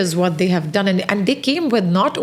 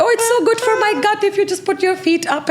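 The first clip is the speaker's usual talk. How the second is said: high, fast, gushing parody of a fan; mocking gushing buyer; 12 semitones higher, faster than usual. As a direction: squeal it like an excited convert. Mocking Amazon buyers' enthusiasm.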